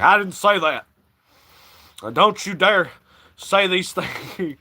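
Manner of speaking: devil turning. Retold or imitated. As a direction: let the mask slip into a hammed-up devil warning. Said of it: "he turns into the devil".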